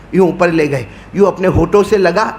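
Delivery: usual speaking voice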